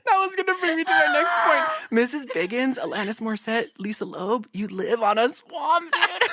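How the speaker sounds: high, still laughing; amused, cracking up. Real, not acted. Host still laughing while listing names, high.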